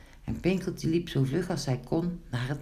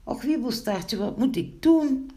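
désemparé; 10 semitones higher than usual. Acted. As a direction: wail it to your friend, rising in panic. Distressed Pinkeltje pleading for help.

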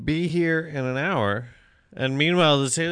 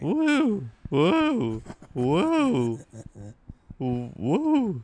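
high woo-woo alien call; alien sound; 5 semitones higher, much slower than usual. Pretend. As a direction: hoot like a strange alien. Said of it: "what's your sound?".

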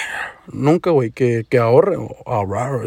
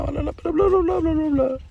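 high, soft dismissive mockery; mocking high voice; 11 semitones higher, 5 dB quieter than usual. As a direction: brush it off in a silly high voice. Mocking his own self-talk.

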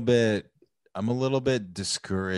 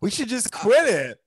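mocking imitation; 12 semitones higher, 5 dB louder than usual. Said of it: "You say".

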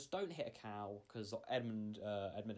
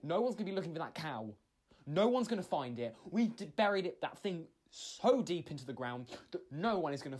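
building angry scolding; 3 semitones higher, 7 dB louder than usual. Retold or imitated. Scolding panicked friend after slap.

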